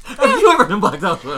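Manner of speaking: high, loud, hammed-up counter-question; mock indignant comeback. Asked if blackout drunk, throws it straight back, mock indignant yell. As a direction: fire the question back at a comic shout.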